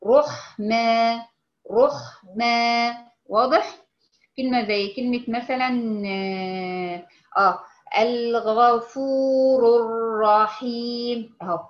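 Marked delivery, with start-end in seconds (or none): 0.1-3.0 رحمة very slow with a thick rounded R; تفخيم الراء. Shown as a demonstration. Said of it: "رو، وحسين الضم". 7.4-11.4 الغفور الرحيم drawn out slowly with a heavy R; slow recitation. Shown as a demonstration. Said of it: "رو الراء".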